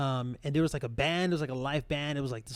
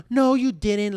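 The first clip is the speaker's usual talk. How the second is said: indignant retort; 4 semitones higher, 5 dB louder than usual. Retold or imitated. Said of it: "kinda like".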